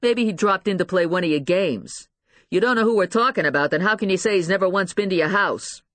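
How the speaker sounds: badgering detective, voice rising; accusing. A narrator's reading. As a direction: pile on the accusations. Detective hammers mother with accusations.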